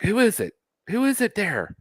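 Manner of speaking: high-pitched mock doctor calling out; frightened movie doctor. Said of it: "And the doctor's like".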